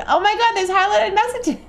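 high, thrilled exclamation; high excited gasp at chat. Real, not acted. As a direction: gush over the messages.

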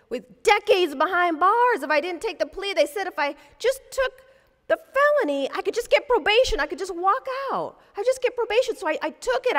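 high, urgent pleading client; agitated pleading. Retold or imitated. Client begging his lawyer, agitated and pleading. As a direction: plead desperately to be heard.

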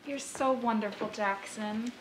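soft gushing. Acted. Girlfriend adoring the host, soft gush. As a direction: coo it at him.

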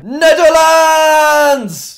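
long, high bellow; playful shout. Pretend. Gamer taking his turn to say the word, playful shout. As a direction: yell NETHERLANDS with the last syllable held long.

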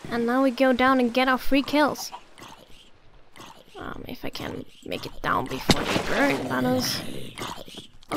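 normal talking voice

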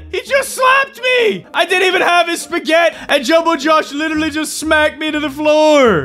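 very high-pitched indignant shouting; outraged yelling. Real, not acted. Player protesting being smacked to the floor, high outraged yell. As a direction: shriek in disbelief at the injustice.

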